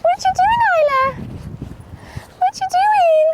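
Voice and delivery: very high, loud pet-talk; calling to animal. Real, not acted. Owner asks Isla what she's doing, high pet voice. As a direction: quiz the animal in a high squeal.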